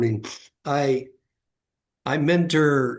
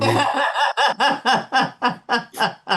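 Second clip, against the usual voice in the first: high, loud burst of laughter; hearty laugh that falls away; real laugh; 12 semitones higher, 4 dB louder than usual. Real, not acted. Laughing at the punchline.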